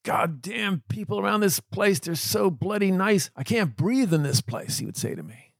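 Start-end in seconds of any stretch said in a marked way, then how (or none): 0.0-3.3 higher, slowed mock yell of a grumpy old man; hammed-up angry patient, higher and slow; mock grumpy scream. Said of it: "he started screaming at me". 3.3-4.8 higher, slowed retold yell; half-acted complaint, higher and slow; mock grumpy yell. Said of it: "he started screaming at me".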